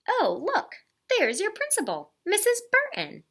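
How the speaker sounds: high, bright excited call; cheerful father. Acted. Father spotting the principal, high cheerful call. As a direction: point her out eagerly.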